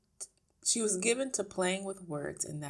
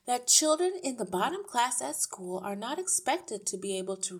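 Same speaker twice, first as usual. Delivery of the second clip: lightly voiced teacher, lilting higher; a hint of emphatic explaining; earnest teacher; 6 semitones higher, 6 dB louder than usual. A narrator's reading. Miss Honey tells parents about Matilda's gifts, earnest.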